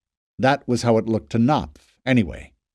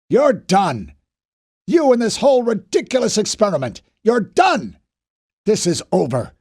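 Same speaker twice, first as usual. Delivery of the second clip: furious rebuke; 8 semitones higher, 4 dB louder than usual. A narrator's reading. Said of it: "jamming a finger into Knopf's chest".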